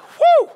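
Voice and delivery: high, loud "whoo"; loud mock-exhausted exhalation cry; exhausted whoop. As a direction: let out a big worn-out whoo. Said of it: "And Jesus was like".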